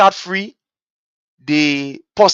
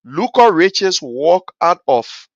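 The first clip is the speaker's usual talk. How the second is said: usual speaking voice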